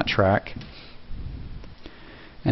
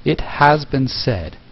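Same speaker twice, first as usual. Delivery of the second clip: slow documentary narration, unprocessed; 3 semitones higher, much slower than usual. Shown as a demonstration. Creator playing back the raw narration, documentary voice. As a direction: speak slowly and clearly, like a documentary narrator. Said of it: "this is what it sounds like so far".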